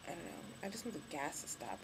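usual speaking voice

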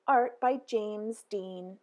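ordinary delivery

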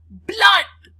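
a wife screaming at the sight of blood; screaming cry. Acted. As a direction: shriek it out in horror. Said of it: "she cried".

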